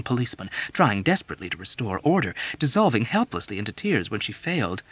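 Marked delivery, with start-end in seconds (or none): none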